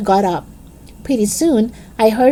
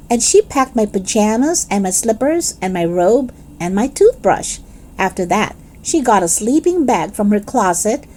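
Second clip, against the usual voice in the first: ordinary delivery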